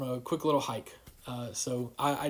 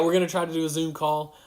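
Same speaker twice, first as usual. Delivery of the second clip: ordinary talk, nothing marked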